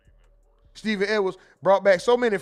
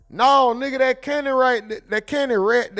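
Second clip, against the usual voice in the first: loud, higher country correction of "sneaker bar"; country accent; 5 semitones higher, 8 dB louder than usual. Retold or imitated. A country friend correcting the streamer on "sneaker bar". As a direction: bark it out in a thick country accent.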